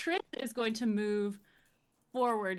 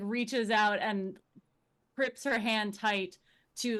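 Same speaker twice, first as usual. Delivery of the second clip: normal talking voice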